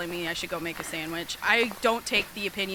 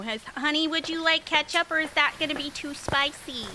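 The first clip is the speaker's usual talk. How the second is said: a hint of a doting mom, higher; lightly voiced sweet mom; mocking mom voice; 5 semitones higher than usual. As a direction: coo like an overprotective mother. Voicing the chatter's mom.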